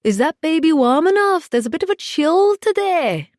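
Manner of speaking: put-on high, loud Scottish old busybody; Marjorie. A narrator's reading. Amy impersonating the nosy widow next door, Scottish accent. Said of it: "impersonation of Marjorie and a flawless Scottish accent".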